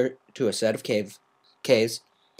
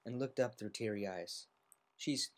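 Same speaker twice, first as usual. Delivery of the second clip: ordinary delivery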